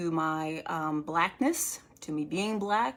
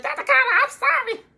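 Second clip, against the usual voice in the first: very high, loud mock wail; apologetic patient; 10 dB louder than usual. Scolded patient apologizing to the doctor, high mock wail. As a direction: squeal out a cowed apology.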